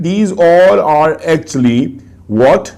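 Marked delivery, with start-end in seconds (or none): none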